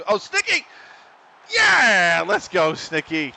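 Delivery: high, loud cheer for Snicky; celebrating. Real, not acted. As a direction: yell out real delight at the goal. Celebrating a goal.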